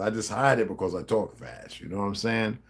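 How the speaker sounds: usual speaking voice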